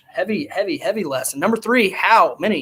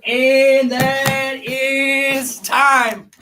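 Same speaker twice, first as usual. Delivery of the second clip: higher, very slow ceremonial call of time; drawn-out time call; 5 semitones higher, much slower than usual. Real, not acted. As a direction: stretch every word out like a ring announcer. Host calls end of countdown.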